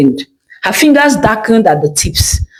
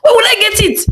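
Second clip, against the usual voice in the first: much higher, pushing demand; exasperated demand; 13 semitones higher, much faster than usual. Acted. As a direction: demand an answer, out of patience. Customer demands her gown.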